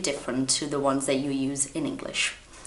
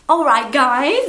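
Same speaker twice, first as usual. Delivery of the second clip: upbeat sign-off; 9 semitones higher, 9 dB louder than usual. Real, not acted. Outro sign-off.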